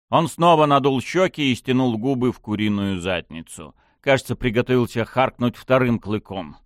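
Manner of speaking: usual speaking voice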